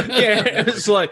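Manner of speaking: laughing, high and loud. Real, not acted. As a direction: burst out laughing. Laughing at caller.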